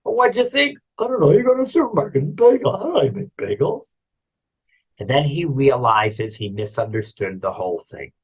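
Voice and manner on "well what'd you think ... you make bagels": high-pitched, flustered excuse; baffled protest. Acted. Onlooker answering the baker, baffled protest. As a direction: protest your ignorance in a higher voice.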